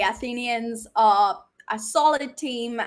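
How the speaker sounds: ordinary talk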